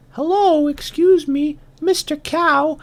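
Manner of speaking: very high, polite ant voice; little ant. Acted. Ant greeting a bull, very high little voice. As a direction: pipe up like a tiny ant. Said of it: "so the ant goes and he says".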